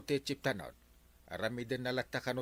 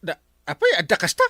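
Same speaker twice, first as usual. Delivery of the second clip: surprised; 2 semitones higher, 11 dB louder, faster than usual. Acted. Startled by surprising news.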